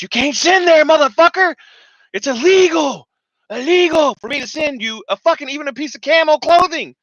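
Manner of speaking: high, loud furious yell; mock angry shout. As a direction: bark the rule like an enraged official. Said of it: "the itar says".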